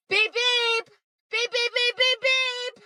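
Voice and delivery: high, insistent spoken beeps; high-pitched vocal horn honks; imitated car horn. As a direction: honk like an impatient car horn. Voices car horn outside house.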